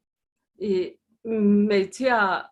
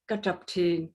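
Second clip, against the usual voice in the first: ordinary delivery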